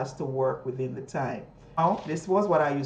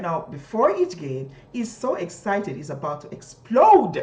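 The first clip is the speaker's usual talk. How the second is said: louder and higher, building to explode; raised excited voice on he's about to explode; raise your voice; 6 semitones higher, 9 dB louder than usual. Shown as a demonstration. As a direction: let the excitement burst out on explode. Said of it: "you raise your voice here".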